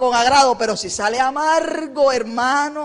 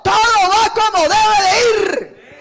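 God speaking; 8 semitones higher, 5 dB louder, slower than usual. Retold or imitated. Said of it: "Dios en el cielo diciendo".

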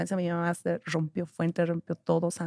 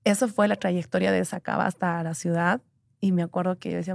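usual speaking voice

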